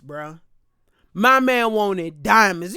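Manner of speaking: ordinary talk, nothing marked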